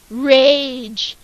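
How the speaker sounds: sudden loud burst on one word; dramatic. A narrator's reading. Narrator reveals Clark's rage, loud burst. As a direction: slam the word out.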